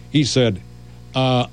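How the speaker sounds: usual speaking voice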